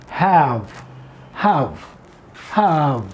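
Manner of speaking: "have" stretched and clear; stressed have. Shown as a demonstration. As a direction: repeat "have" slowly, hitting the vowel. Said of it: "have in contraction".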